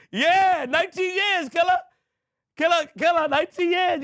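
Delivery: high, loud hyped-up shout of a friend's name; excited greeting. Real, not acted. Greeting an old friend after nineteen years, high and loud. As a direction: cheer him on like a long-lost friend.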